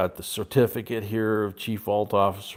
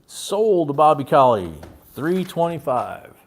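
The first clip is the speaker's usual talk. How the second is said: auctioneer call; 5 semitones higher, 8 dB louder than usual. Acted. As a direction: call the bid to the room. Auctioneer closing the sale.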